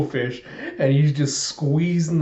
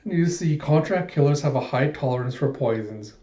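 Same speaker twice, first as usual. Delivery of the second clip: usual speaking voice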